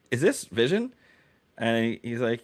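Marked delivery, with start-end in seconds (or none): 0.1-0.9 retold incredulous question, high and rising; high-pitched suspicious check; puzzled disbelief. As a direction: ask it high, as if doubting who is there. Retells questioning the unfamiliar AI voice.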